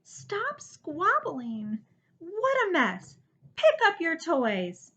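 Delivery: put-on loud scolding shout at the children; yelling. Mother yelling at squabbling children inside, loud scolding. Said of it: "he heard her yelling inside".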